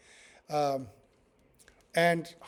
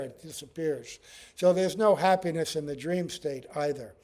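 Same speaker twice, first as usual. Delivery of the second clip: ordinary delivery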